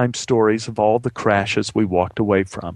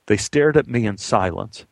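normal talking voice

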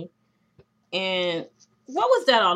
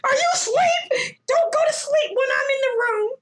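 shrill mocking shriek, much higher and louder; 6 dB louder than usual. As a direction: scream it in a whiny parody of her.

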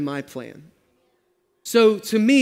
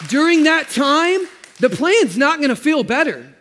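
raised, driving sermon voice; full-force preaching; 5 semitones higher, 4 dB louder than usual. Acted. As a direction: preach it hard to the congregation.

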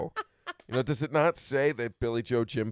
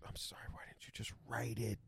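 much softer breathy whisper; 15 dB quieter than usual. Real, not acted. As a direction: whisper it like a secret aside. Whispered aside to the Bible writers.

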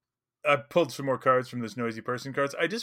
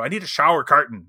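lightly imitated Irish accent, louder; 3 semitones higher, 10 dB louder than usual. Irish teacher asking at Home Depot, imitated accent. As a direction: put on an Irish teacher's brogue. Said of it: "nobody could understand a word he was saying".

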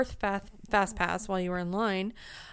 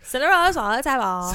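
put-on Valley Girl voice; 5 semitones higher, 9 dB louder than usual. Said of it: "You said that like a Valley Girl".

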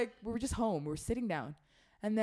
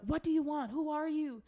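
retold scared yelling; 9 semitones higher than usual. Kids yelling at intruder.

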